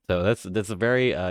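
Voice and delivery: ordinary talk, nothing marked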